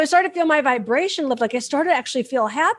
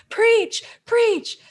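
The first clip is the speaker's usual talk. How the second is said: high, eager Preach, preach; excited cheer; 9 semitones higher than usual. Retold or imitated. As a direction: cheer him on from the back like a fan. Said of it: "My soul was like".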